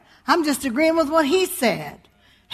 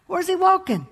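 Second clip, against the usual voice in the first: ordinary talk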